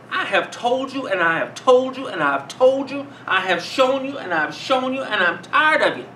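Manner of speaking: fed-up scolding, pounding every repeat; very angry. Retold or imitated. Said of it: "And I might be very angry".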